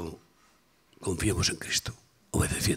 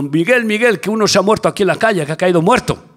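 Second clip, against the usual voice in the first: higher, louder urgent call, the middle line rushed; wife calling out; 6 semitones higher, 7 dB louder, faster than usual. Retold or imitated. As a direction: relay the wife's alarmed shout, somewhat louder. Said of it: "me dice mi mujer gritando". (la esposa llamando a Miguel, alarmada, más alta y fuerte)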